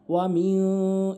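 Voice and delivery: মিউ slowly hummed through the nose; ikhfa. Shown as a demonstration. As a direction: hide the n of মিউ in a nasal hold. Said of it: "নাকের ভিতরে নেবেন". (মিউ নাকের ভিতরে টেনে)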